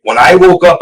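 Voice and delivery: normal talking voice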